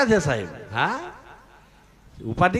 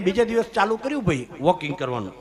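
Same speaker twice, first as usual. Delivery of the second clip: ordinary delivery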